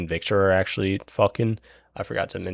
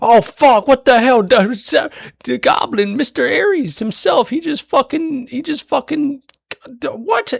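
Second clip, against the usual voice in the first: much higher, louder, stunned babble; stunned crowd; 7 dB louder than usual. Retold or imitated. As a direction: squeal it like a crowd in total shock. Crowd stunned by Ares' hanging.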